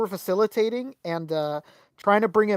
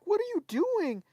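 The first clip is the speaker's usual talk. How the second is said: upset fan; 11 semitones higher, 5 dB quieter than usual. Retold or imitated. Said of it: "looks at Billy all, like, upset".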